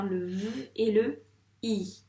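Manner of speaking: "V" as a held vvv, then "I" on its own; separated sounds. Shown as a demonstration. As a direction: split "vie" into buzzed "V" and long "I". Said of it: "qu'est-ce que tu entends".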